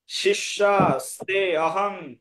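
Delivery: শিষ্যা স্তে অহং slowly, split into separate pieces; model pronunciation. Shown as a demonstration. Teacher correcting the recitation, slow careful শিষ্যা স্তে অহং. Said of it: "উচ্চারণ হবে এখানে".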